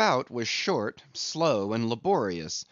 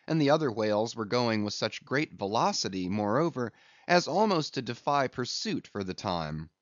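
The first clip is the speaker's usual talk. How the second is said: plain reading voice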